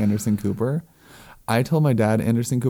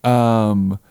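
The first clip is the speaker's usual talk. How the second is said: normal talking voice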